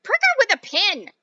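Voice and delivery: loud, high urgent call; urgent father. Acted. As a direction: call out the remedy. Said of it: "said one of the other fathers".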